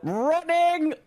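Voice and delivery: very high, loud drawn-out shriek; hammed-up panicky holler; fleeing cry. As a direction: squeal it out as you bolt. Player calls out while fleeing.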